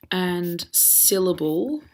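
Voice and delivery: slow, careful dictation; dictating slowly. Shown as a demonstration. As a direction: spell it out slowly for the class. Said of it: "dictating the title slowly".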